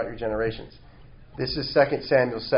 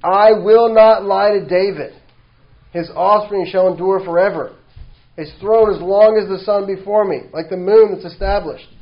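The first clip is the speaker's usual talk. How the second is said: preaching at full force; 5 semitones higher, 8 dB louder than usual. Acted. Preacher proclaiming God's promise to David, loud and high. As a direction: proclaim it like an oath that cannot break.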